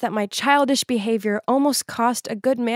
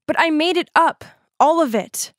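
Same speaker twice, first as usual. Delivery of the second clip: blurted out in a rush, a bit higher; 5 semitones higher, 4 dB louder than usual. A narrator's reading. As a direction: blurt it out suddenly. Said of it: "then I blurted out".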